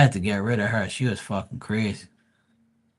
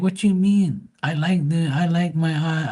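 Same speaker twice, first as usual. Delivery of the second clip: lightly voiced girlfriend, higher and puzzled; a hint of a whiny ex; mimicking girlfriend; 6 semitones higher than usual. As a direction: play her innocent and a bit confused. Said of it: "She's like".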